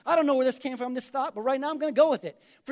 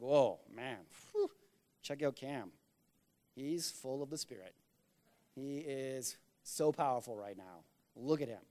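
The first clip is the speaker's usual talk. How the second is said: much lower and softer, slowed, hushed gawking; mock awed bystander; 11 semitones lower, 12 dB quieter than usual. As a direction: gape in hushed mock awe.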